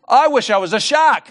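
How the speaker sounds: little prawn making a wish; Derek the prawn wishing. Acted. Prawn Derek makes his wish.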